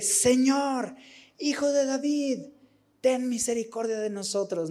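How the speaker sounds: imitating a desperate cry for mercy; desperate plea. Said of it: "Con más desesperación".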